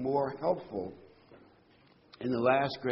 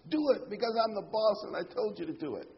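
bossy master; 9 semitones higher, faster than usual. Retold or imitated. Bossy master voiced in sermon.

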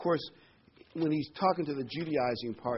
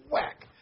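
mock whack; 5 dB louder than usual. Teacher hitting gum chewers.

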